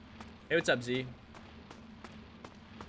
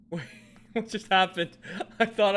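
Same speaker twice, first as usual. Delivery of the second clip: laughing, louder and higher; laughing surprise; 9 semitones higher, 10 dB louder than usual. Real, not acted. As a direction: laugh through the confused question. Confused after sudden fall.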